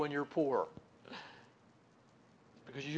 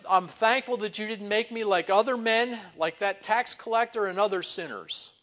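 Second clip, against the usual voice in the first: bragging; 6 semitones higher, 9 dB louder than usual. Retold or imitated. Pharisee praying to boast of himself, loud and proud. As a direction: puff up and brag to God. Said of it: "he's bragging on his own righteousness".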